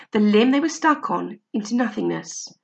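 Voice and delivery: usual speaking voice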